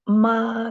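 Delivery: "Ma" held high and level; first tone. Shown as a demonstration. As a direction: sustain "Ma" on one high note. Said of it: "the first tone".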